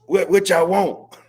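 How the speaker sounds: high mock voice of officials brushing them off. Imitating city officials telling them to leave, mock voice. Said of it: "They be quick to say".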